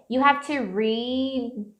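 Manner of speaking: slow "read" ending on an unreleased d; stretched "read", its d held in; held-back D. Shown as a demonstration. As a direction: stop on "read" without releasing the d. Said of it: "eu dou uma segurada nesse D".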